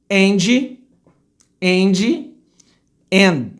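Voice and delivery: "And" as "andgy"; Brazilian pronunciation. Shown as a demonstration. Teacher mimicking Brazilians, "And" with final i. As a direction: add "jee" after the d of "And". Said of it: "vou falar como brasileiro falaria".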